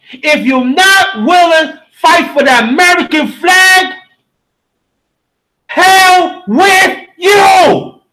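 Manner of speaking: furious rallying cry; angry declaration. Real, not acted. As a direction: spit out the last three words slowly. Angry preaching climax.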